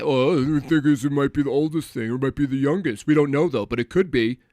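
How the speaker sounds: lightly voiced smug expert; mocking scientist voice. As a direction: sneer it like a pundit. Mocking scientists on Star Talk.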